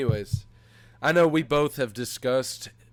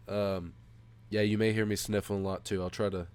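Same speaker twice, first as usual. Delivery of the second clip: normal talking voice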